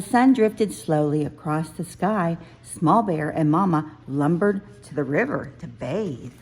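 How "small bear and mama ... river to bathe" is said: plain reading voice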